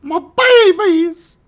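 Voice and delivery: high mock-anguished wail, drawn out. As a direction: wail it like a distraught parent. Tokens threatened by monster.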